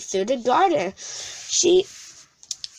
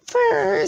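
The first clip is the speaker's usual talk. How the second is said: high purring cat sound; 12 semitones higher, 3 dB louder than usual. A narrator's reading. Wilbur the cat purrs at bedtime, high purr. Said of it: "said Wilbur".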